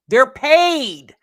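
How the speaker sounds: "paid" punched loud and high. Real, not acted. Host insists the famous kid's account is monitored, loud. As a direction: hammer "paid" home the second time.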